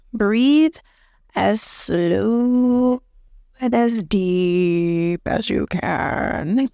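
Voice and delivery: slow, drawn-out breathing instruction; calm, drawn-out guide voice; slow demo. Shown as a demonstration. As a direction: stretch each word like a long exhale. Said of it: "demonstrating slow deep breathing".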